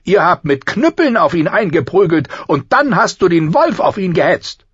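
high, loud accusing prince voice; spoiled prince accusing. Acted. Prince Joffrey accuses Arya in front of the king, high and indignant. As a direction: point the finger, whiny and sharp.

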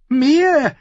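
very high, loud squeak of alarm. Acted. Lord Ammon squeaking in alarm at the council. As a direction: squeak out in shock. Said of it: "piepste Lord Ammon".

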